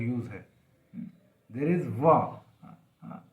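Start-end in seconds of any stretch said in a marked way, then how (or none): none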